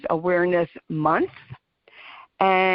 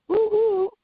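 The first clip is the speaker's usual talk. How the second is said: high whooping cheer; excited woo-hoo; 13 semitones higher than usual. Real, not acted. Host celebrating Amazon Music news, high happy whoop. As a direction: squeal it up high in delight.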